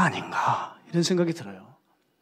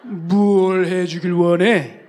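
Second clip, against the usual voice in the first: put-on slow, loud old pastor asking what you want; Park Jang-won impression; 9 dB louder, much slower than usual. Elderly pastor asks the young preacher his wish, slow and loud. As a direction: drawl it out like a commanding elderly pastor. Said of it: "이게 이제 박장원 목사님 버전이에요".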